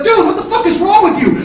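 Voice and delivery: high, loud alarmed protest; shouted disbelief, voice way up; retold screaming. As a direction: yell at your out-of-control brother. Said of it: "and they're screaming".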